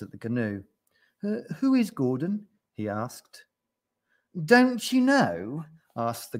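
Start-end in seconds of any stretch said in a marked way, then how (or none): 1.2-2.5 high, hesitant question; puzzled yak. A narrator's reading. Yak stops dancing to ask gnu, puzzled. 4.4-5.6 slow, high, incredulous question; incredulous gnu. A narrator's reading. Gnu questions the clueless yak, incredulous. Gnu amazed at yak's ignorance.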